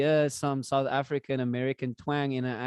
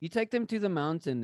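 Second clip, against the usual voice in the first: ordinary talk, nothing marked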